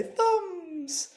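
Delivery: "Thumbs" sung out very high. Shown as a demonstration. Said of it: "we're gonna go high and say".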